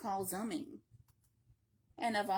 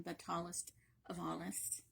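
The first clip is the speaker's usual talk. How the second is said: whisper; 5 semitones lower, 8 dB quieter than usual. Acted. Savouring line to child.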